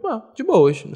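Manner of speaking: not rude. Retold or imitated. Friend reacting to a colleague's short message, unbothered. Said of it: "Não li com uma entonação de ser grosseiro".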